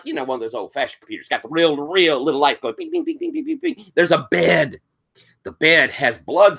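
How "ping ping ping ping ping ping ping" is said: high, soft beeping pings; computer ping. Acted. Narrator voices the old computer light, high soft pings. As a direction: imitate a little machine pinging.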